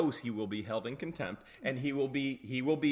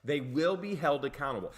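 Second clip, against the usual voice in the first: usual speaking voice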